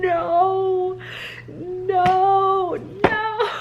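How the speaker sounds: high, wailing no's on the verge of tears; high pained protest; real distressed cry. Real, not acted. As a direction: cry out in dismay. Distraught over book ending.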